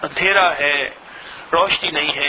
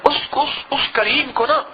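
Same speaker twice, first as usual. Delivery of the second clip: crying voice; 6 semitones higher than usual. Real, not acted. Voice breaks, crying heard.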